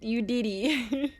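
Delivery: drawn out slowly with a teasing rise at the end; slow teasing drawl. Acted. Teasing chat for the meme, slow teasing drawl. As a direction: stretch it out, playfully accusing. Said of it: "Don't say I didn't do it".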